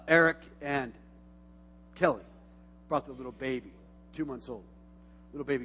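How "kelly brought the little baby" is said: normal talking voice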